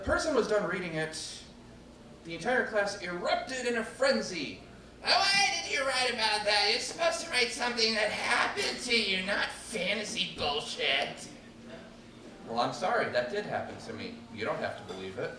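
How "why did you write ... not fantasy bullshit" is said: indignant outburst. Retold or imitated. Classmates angrily protesting.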